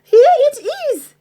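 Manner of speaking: high, loud, fast excited squeal; excited cry. Acted. Peg spotting the treasure chest, high excited cry. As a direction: squeal with delight at the find. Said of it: "cried Peg Polkadot".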